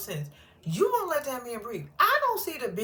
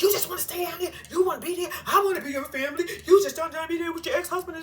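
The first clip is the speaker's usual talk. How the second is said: higher, hammed-up mock hollering; imitating angry yelling; 8 semitones higher, 4 dB louder, faster than usual. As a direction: play the jealous man hollering down the phone. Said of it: "Willie was hooping and hollering on the phone".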